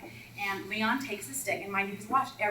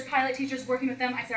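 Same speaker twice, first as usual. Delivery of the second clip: ordinary talk, nothing marked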